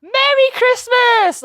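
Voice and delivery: high, slow, loud festive cheer; drawn-out Merry Christmas call; festive shout. Acted. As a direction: belt it out like a Christmas greeting.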